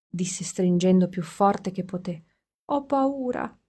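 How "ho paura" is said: put-on higher, soft child's fear; a frightened little girl clinging; small scared voice. Little girl tells her father she is scared, high and small.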